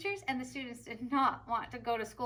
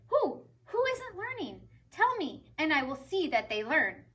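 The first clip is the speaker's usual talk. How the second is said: high, urgent questions; anxious to fix it at once; worried principal; 4 semitones higher, 5 dB louder than usual. A narrator's reading. Principal to Tilly, high and urgent. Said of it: "He looked very worried".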